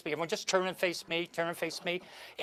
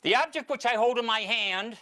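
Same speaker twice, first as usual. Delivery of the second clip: raised, grand stage-mentalist delivery; mock psychic reveal; 5 semitones higher than usual. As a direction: announce the reading like a showman.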